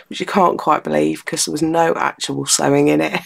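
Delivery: ordinary talk, nothing marked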